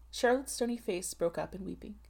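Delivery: usual speaking voice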